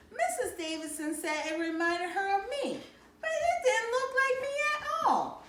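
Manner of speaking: put-on high, rising complaining child's voice; little girl protesting, pitch climbing high; upset child. A narrator's reading. Joey tells Mama the angel looks nothing like her, high and upset.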